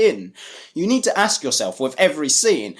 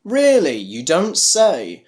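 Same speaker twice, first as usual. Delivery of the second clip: sarcastic; slower than usual. Real, not acted. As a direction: feign amazement at the obvious, stretching it slowly. Sarcastic reaction to bad lines.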